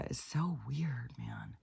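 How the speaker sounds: near-whisper, slow and amazed; hushed wonder. Real, not acted. Musing to viewers on strange realities, hushed wonder. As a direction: voice drops to a whisper.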